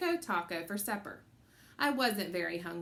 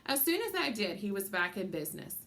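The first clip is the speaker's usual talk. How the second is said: plain reading voice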